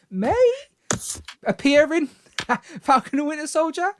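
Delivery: MAY pitched high and rising; really high-pitched. Shown as a demonstration. Host hedging a rumour to Brandon, really high-pitched MAY. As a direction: lift "may" right up, doubtfully. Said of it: "I say that with a really high pitched voice".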